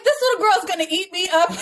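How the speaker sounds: high, rushed, breaking into laughter; laughing mock alarm. Mother joking about her blooming daughter, high and laughing. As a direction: squeal it through a laugh.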